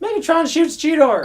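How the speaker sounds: high, loud excited outburst; mock alarm. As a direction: cry out the shock in a high voice. Excited exclamation amid laughter.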